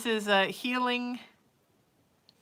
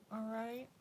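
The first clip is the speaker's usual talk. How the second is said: pet talk; 3 semitones higher, 5 dB quieter than usual. Real, not acted. Telling her cat Sir Snuggles to go be a big boy, soft cooing pet voice. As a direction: coo at the cat gently.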